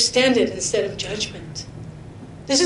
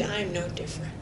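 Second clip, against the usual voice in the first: quiet self-rebuke; 3 semitones lower, 12 dB quieter, faster than usual. Retold or imitated. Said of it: "and then I think".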